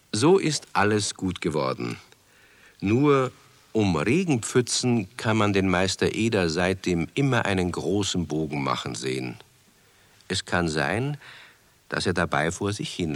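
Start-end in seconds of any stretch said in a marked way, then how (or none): none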